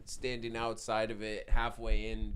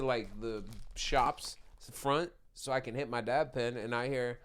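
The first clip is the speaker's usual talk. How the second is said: normal talking voice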